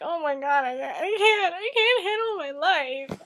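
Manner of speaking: overdone weepy wail, half joking; mock sobbing. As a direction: act falling apart over the book, playful. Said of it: "and I'm just like".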